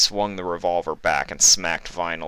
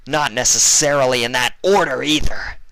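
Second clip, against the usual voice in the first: higher, louder warning; urgent threat; 8 semitones higher, 8 dB louder than usual. Acted. Vinyl threatening Iceberg to save Fluttershy. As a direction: warn him hotly.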